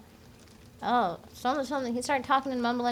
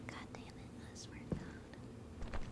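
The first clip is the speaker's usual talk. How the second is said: whispered swearing under the breath; muttered curse; 14 dB quieter, slower than usual. Real, not acted. Cursing under the breath in game.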